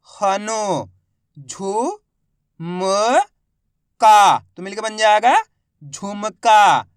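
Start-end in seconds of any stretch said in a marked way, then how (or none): 4.0-6.9 drawn-out blending. Shown as a demonstration. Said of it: "blending syllables into a word".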